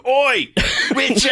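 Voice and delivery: high, loud mock shout of Oi Witcher. Performing the catchphrase for friends, loud mock shout. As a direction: bark it out like a rough villager. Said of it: "everybody in that area going".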